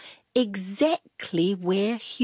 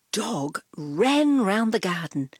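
plain reading voice

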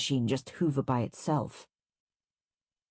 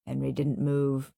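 ordinary delivery

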